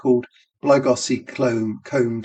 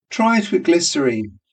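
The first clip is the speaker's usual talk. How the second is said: advising; 6 semitones higher, faster than usual. Acted. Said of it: "Miss Kennedy advised".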